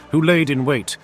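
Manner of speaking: plain reading voice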